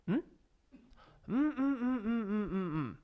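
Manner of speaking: high, soft closed-mouth hums; polite hummed laugh. Retold or imitated. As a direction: hum a quiet, shy laugh. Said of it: "Japanese people are like".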